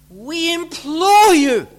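a loud, slow appeal on implore; strong imploring. Shown as a demonstration. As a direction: implore them with force. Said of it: "a very strong word".

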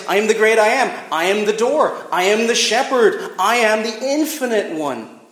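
high, loud ringing proclamation; proclaiming. Acted. Preacher proclaims Jesus' names to the congregation, loud and high. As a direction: lift each I am to the rafters.

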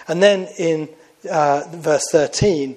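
usual speaking voice

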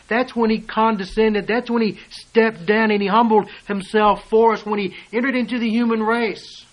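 loud, high, ringing pulpit voice; full-force preaching. Acted. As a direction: preach it out hard to the congregation.

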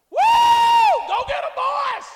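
very loud, high whoop and cheer; exuberant battle shout. Retold or imitated. David cheering the army into battle, exuberant shouting. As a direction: whoop at full voice to rally troops. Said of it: "Bible said that David shouted".

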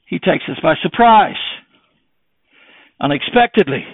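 high, loud, driving preacher's cry; preaching at full force. Acted. Preacher warning the congregation of Satan, loud and high. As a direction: hammer the warning home to the church.

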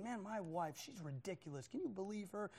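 grouse under your breath; bitter grumbling. Acted. Husband alone driving to work, bitter grumbling. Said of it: "the whole time he gets bitter".

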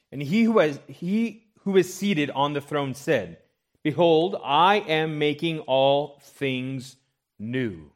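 preacher reads God's words from the throne, proclaiming; scripture reading. Acted. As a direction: declaim the verses with weight.

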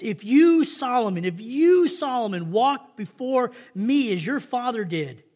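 preacher voicing God addressing Solomon; emphatic scripture reading. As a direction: proclaim God's charge with punched key words. Reads God's charge to Solomon.

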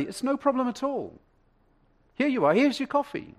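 very high, chirpy eager to please; sweet cashier. Acted. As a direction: serve him sweetly, pitch right up. Rachel gladly serves paid coffee.

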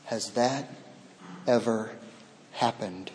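low, soft personal question; intimate hush. Acted. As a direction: lean in and ask gently.

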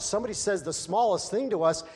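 ordinary delivery

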